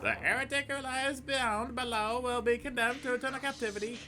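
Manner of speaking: high bishop voice. Acted. Streamer voicing the evil bishops, high and sinister. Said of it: "I'm gonna forget all those voices".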